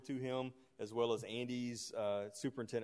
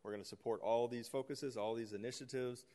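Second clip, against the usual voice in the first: ordinary talk, nothing marked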